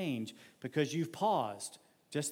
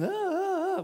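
high, strangled cries of frustration; flustered yelp; 5 dB louder than usual. Retold or imitated. Worker mocking his own frustration at the angry boss, high yelps. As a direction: let out short choked yelps, way up high. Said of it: "you're just going".

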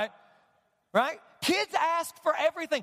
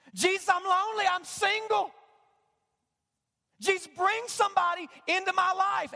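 mock whiny prayer; 7 semitones higher than usual.

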